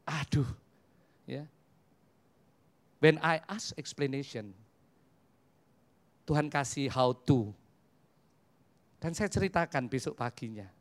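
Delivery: usual speaking voice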